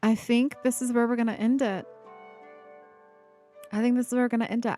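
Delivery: ordinary delivery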